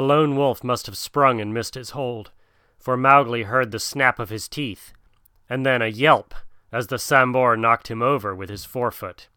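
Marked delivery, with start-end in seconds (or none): none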